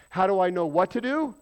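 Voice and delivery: loud, high mock questioner; voiced believer asking. Real, not acted. Pastor voicing a believer asking God, loud and pleading. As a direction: play the confused believer asking what now. Preaching question at full force.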